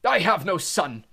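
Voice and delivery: loud, high, slow furious bellow. Acted. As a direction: disown the son with rage. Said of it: "bellowed Mister Crouch".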